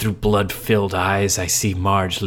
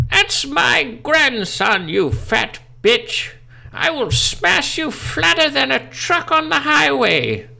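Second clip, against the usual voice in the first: very high, slow, loud battle cry; furious granny; 4 dB louder, slower than usual. Acted. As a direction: shriek like an enraged old lady. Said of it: "she cried out".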